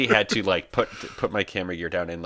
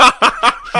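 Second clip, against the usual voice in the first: high, loud burst of disbelieving laughter; breathy scoffing laugh; incredulous laugh; 11 dB louder than usual. Retold or imitated. Laughing at absurd request.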